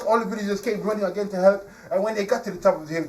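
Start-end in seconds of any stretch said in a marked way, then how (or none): none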